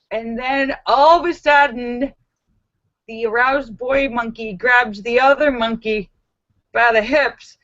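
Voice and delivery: normal talking voice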